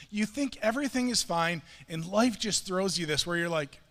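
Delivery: normal talking voice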